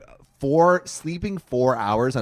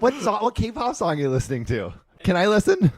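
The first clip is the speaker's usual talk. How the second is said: higher, eager pestering voice, lightly done; mock pestering seatmate; 8 semitones higher than usual. Mocking the chatty seatmate on the plane, eager and higher. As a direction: play the clingy seatmate who won't stop asking. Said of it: "And then he's like".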